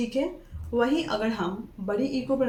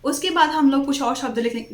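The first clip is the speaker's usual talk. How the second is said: ordinary delivery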